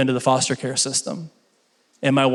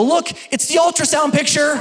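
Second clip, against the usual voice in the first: much higher, playful announcement; proud mock father-to-be; excited joke; 11 semitones higher than usual. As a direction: hand over the phone like big news. Joke to wife, pitched up.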